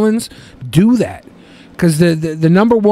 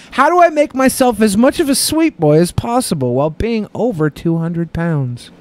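higher, theatrical letter read; letter read aloud; 5 semitones higher than usual. Acted. Host reading a fan question to co-hosts, theatrical. Reads second listener letter.

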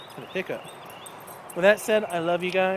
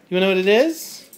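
ordinary talk

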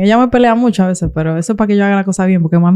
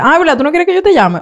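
high, sarcastic put-on mother; pitched-up mock mom retort; mock offended mom; 8 semitones higher, faster than usual. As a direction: play the hurt mom throwing it back. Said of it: "y tú dices".

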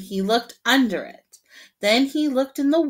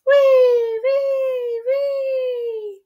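much higher, louder squeaks; squeaky wees; 7 dB louder, much slower than usual. A narrator's reading. Narrator imitating a squeaky noise in the night, high squeal. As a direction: squeal high like a tiny creature.

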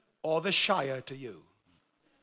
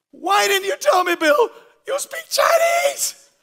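loud, high exasperated shout; venting shock and anger at a friend; frustrated yelling at Bill; 11 semitones higher, 11 dB louder, slower than usual. Retold or imitated. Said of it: "I'm like".

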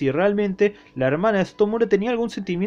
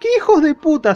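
sudden high, loud outburst of indignation; outraged exclamation; 10 semitones higher, 5 dB louder than usual. Real, not acted. Recapper rails at the rugby players, loud and outraged. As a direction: explode in mock outrage at the villains.

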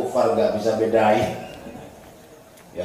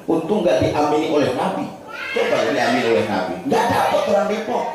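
ordinary talk

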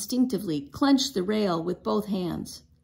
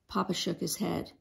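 plain reading voice

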